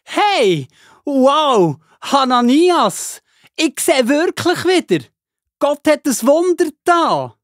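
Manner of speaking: overjoyed cry of a healed man; joyful amazement. Acted. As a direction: Saulus shouting to Hananias that he can see. Saulus can see again.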